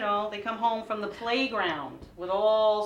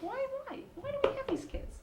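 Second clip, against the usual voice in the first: much higher, pleading whine; exasperated wondering; 5 dB quieter than usual. Retold or imitated. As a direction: wail the question up high like a frazzled mom. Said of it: "I'm like".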